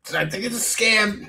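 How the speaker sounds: high put-on silly voice; playful, pitched far above normal; mock reveal. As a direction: squeak out an obvious spoiler. Mock reveal after spoiler alert.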